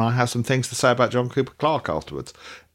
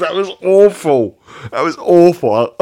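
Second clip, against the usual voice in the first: laughing; 9 semitones higher, 10 dB louder, slower than usual. Real, not acted. Cringing at own accent attempt.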